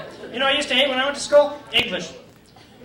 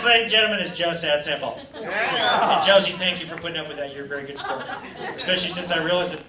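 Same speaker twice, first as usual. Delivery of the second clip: normal talking voice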